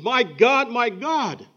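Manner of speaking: loud, high anguished cry to God; Jesus on the cross calling out loud and high to the Father. Acted. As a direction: cry it out to heaven in agony. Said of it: "he cried out to the Father".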